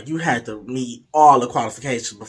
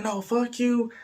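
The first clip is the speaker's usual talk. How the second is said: high-pitched mock retort; cussing back; 9 semitones higher, 3 dB quieter, slower than usual. Date throws the curse back at storyteller, mock-angry. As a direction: imitate a petulant comeback. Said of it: "We cussing each other out".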